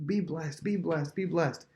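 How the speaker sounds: shower blessings on the household, voice lifted; imitated joyful blessing. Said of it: "just enjoy his".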